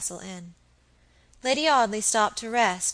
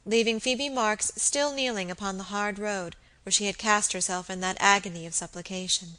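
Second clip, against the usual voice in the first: usual speaking voice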